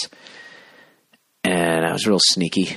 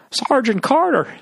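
high, drawn-out comic call. Acted. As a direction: call out for the sergeant in a high, stretched voice. [name] impression after joke.

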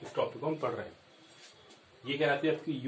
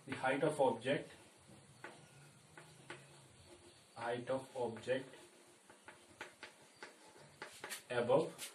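slow note dictation; 9 dB quieter, much slower than usual. Acted. Teacher dictating notes slowly.

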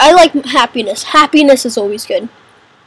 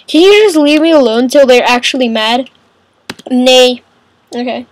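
normal talking voice

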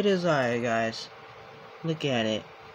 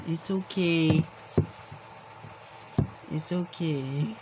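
soothing pet; 8 dB quieter than usual. Real, not acted. Soothing crying pet cat.

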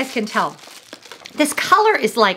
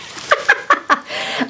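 short, high giggle at her own joke; light laugh; 6 semitones higher than usual. Real, not acted. Laughing at her own age joke, light giggle.

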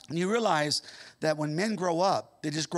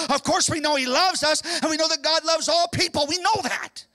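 loud, high, ringing sermon voice; fervent revival preaching; full-force preaching; 7 semitones higher, 5 dB louder than usual. Acted. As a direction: preach it out to the whole church.

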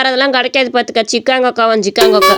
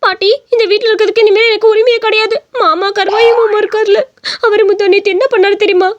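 high, hurt whine to grandma; acted weepy, wronged girl; tearful complaint; 9 semitones higher than usual. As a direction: complain tearfully in a raised pitch. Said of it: "கருவாச்சி எதுக்கு அழுதுகிட்டு கிடக்குது".

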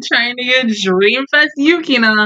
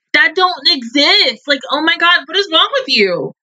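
exasperated; 7 semitones higher than usual. Real, not acted. Exasperated rant at the screen.

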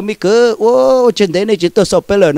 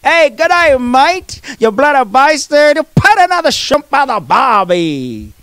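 hammed-up Aussie accent, higher at the start; mock Australian; 4 semitones higher, slower than usual. Teasing Australians in a mock Aussie accent. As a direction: camp up the Aussie tourist. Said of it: "you Australians".

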